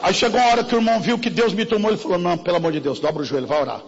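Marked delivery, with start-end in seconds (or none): 2.2-3.8 lower, softer, hurried pleading rebuke; impatient brother hushing her; mock exasperated brother.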